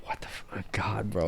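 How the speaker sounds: stunned mock whisper; whispered shock. Acted shocked reaction, unvoiced.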